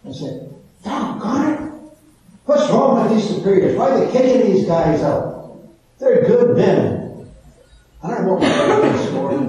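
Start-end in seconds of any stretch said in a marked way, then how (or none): 0.8-7.3 frustrated outburst. Retold or imitated. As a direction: vent like a fed-up seminarian. Retold anger at superiors.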